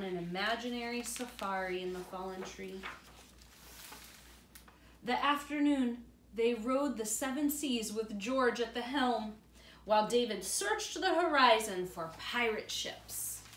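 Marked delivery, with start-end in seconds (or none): 5.0-13.4 put-on reading aloud to children; story narration. A narrator's reading. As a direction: tell it warmly to little ones.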